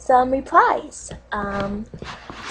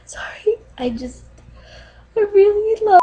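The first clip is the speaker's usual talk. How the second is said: higher, tearful catch in the voice; choked up; 8 semitones higher, faster than usual. Pretend. Apologizing on a dating video for getting emotional, choked up. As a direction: fight back tears and fail. Choking up over cats.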